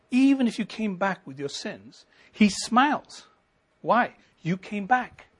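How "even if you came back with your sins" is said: normal talking voice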